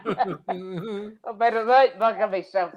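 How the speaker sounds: slow, still laughing. Real, not acted. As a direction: laugh it out at yourself. Laughing at own blunder.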